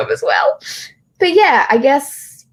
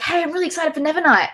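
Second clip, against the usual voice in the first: high-pitched, quoted excitement; chirpy mock-fan voice, pitched up; eager fan message; 8 semitones higher, faster than usual. Voicing an eager fan's message.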